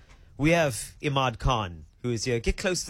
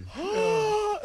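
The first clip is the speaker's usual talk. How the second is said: shocked gasp. Real, not acted. Shocked at coach's joke.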